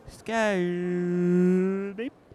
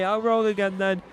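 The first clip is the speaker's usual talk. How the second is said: ordinary talk, nothing marked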